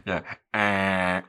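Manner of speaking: imitated Irish-comic "eh"; mock Irish filler sound; Dara Ó Briain. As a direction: draw out the "eh" as a mimicked hesitation. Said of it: "That's a bit more Dara Ó Briain".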